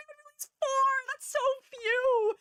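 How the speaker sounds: squealing high dismay; high exclaiming. Real, not acted. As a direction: cry it out in mock horror. Dismay at failed goal.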